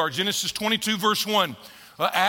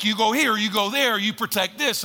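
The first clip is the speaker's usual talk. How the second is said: ordinary talk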